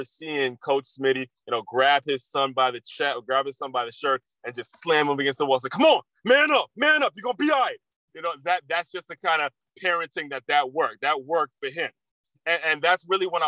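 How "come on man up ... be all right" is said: high, loud coaching yell. Retold or imitated. Coach yelling at his son against the wall, high loud yell. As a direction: bark him into manning up.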